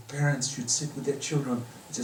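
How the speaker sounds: normal talking voice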